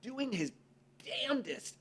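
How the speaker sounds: high, hushed then rising awe; awed admiration. Retold or imitated. People admiring someone's hard work, awed. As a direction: marvel at him, building up. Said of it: "look at each other and go".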